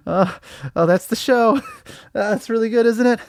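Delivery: high, laughing sobs swallowing the words; breaking into tears. Acted. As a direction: crack up into a tearful meltdown. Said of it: "I cried, and I'm sorry".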